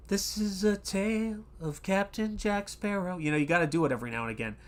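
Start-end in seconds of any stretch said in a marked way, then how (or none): 0.1-3.2 much higher, softer storyteller voice; movie quote voice. Retold or imitated. Host quotes Pirates of the Caribbean, soft higher dramatic voice. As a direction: lightly voice the film's dramatic opener.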